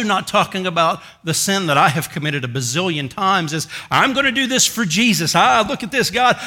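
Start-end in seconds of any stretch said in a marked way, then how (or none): 3.9-6.4 higher, louder, gushing mock zeal; preacher mimicking a self-sure schemer, hammed up; mock eager believer. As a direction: lampoon someone presuming on God. Mocking presumptuous prayer.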